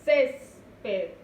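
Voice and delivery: "Césped" with a loud, held CÉS, then a pause before PED; two separated syllables in "Césped", first one strongest; stressed first syllable. Shown as a demonstration. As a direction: hit CÉS in "Césped", let PED drop. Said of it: "La mayor fuerza de voz".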